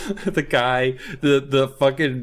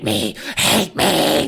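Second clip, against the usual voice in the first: creepy taunt; 3 semitones higher, much slower than usual. Retold or imitated. The creature taunting.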